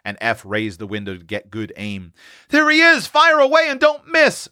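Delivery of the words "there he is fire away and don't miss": put-on high, urgent boy's cry; excited young boy urging the shot. A narrator's reading. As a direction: call the alarm high and excited. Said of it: "cried Seth".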